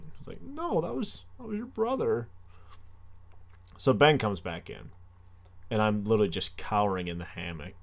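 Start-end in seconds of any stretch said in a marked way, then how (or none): 0.4-2.3 high, sing-song mock answer; high mock reply. Teasing the neighbors, high mock reply. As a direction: toss back a high teasing retort. Retelling what she'd answer.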